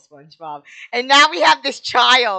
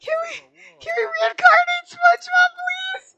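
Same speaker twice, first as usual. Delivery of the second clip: hammed-up wailing grief; 4 dB louder than usual. Real, not acted. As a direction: overact the grieving friend, wailing. Begging then scolding Patrick.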